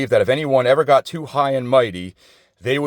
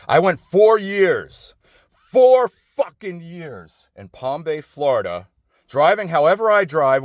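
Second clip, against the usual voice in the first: slow, higher, stretched-out four years; exasperated disbelief, slow and higher; indignant outrage; 7 semitones higher, slower than usual. Real, not acted. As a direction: drag it out, outraged and incredulous. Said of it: "indignant about honking".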